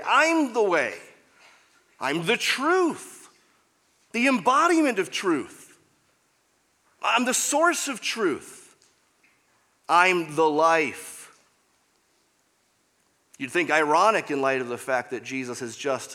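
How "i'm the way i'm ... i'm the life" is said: high, loud, slow emphatic proclamation; preaching at full force. Acted. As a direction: build each claim like a herald. Preaching Jesus' claims.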